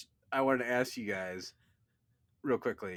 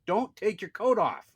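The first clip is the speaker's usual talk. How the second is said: louder, higher, slow mock scolding; retold exasperated dad, voice raised; insistent order; 8 semitones higher, 6 dB louder, slower than usual. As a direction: drive the order home slowly and loudly. Louder repeat of order to son.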